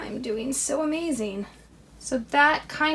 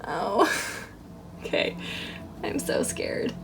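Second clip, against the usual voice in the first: scared whisper; 3 semitones lower, 6 dB quieter than usual. Real, not acted. Nervous whisper during craft.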